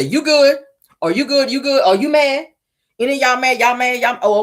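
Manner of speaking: high, rapid-fire mock check-in; pointed questioning. Stormy pointing at everybody in the room, high insistent questioning. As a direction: imitate someone polling the room, pitched well up.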